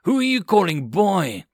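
insulted aide half drawing his sword, growling; angry growl. A narrator's reading. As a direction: bristle at being called boy. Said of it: "The aide growled".

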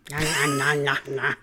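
talking through a mouthful; words round a bite of food; chewing while talking. Real, not acted. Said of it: "I'm chewing on a bone over here".

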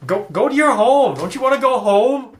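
high, loud angry yelling; angry man yelling. Retold or imitated. GM voicing the angry man behind the dragon, yelling. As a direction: shout her toward home from behind. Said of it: "you have the angry man".

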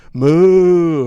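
long, loud, high moo; cow moo. Retold or imitated. Travellers herded like yelled-at cattle, mooing. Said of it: "You know, we're cattle".

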